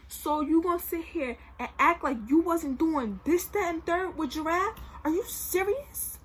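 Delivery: loud, high angry confrontation; pissed off. Retold or imitated. Girlfriend confronting her cheating boyfriend, pissed off. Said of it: "And I'm pissed off".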